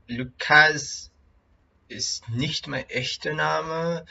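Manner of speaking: ordinary talk, nothing marked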